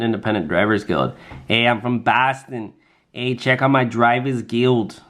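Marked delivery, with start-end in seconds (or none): 1.5-5.0 badly faked Boston accent, slow and drawn out; slow mock Boston accent; horrible Boston accent. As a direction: put on a hammy, unconvincing Boston accent. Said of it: "That's a horrible Boston accent".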